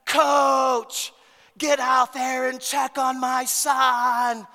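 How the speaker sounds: high, shrill shouting mother, slow and loud; yelling mother. Retold or imitated. Angry mother in the stands yelling at the coach. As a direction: yell across the field at the coach. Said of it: "I hear this woman's voice".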